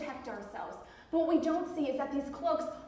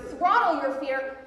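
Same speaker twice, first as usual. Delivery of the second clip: Throttle punched louder, slow and forceful; louder repeat; 8 dB louder, slower than usual. Shown as a demonstration. Speaker repeats the slogan to the crowd, louder punch. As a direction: hammer the slogan home.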